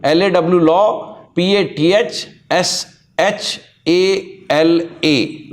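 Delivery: slow letter-by-letter dictation; spelling it out slowly. Shown as a demonstration. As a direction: dictate each letter so students can write it down. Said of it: "मैं फिर से बोल दे रहा हूँ". (धीरे-धीरे अक्षर बोलकर स्पेलिंग लिखवाना)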